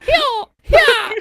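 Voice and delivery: very high, loud grunting Chris Pratt; Chris Pratt impression. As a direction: grunt it like a fighting game hero. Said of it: "Chris Pratt going".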